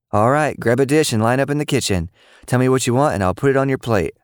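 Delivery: a mother directing the kids, lightly voiced; mother's voice. A narrator's reading. Mom telling the kids to line up, brisk.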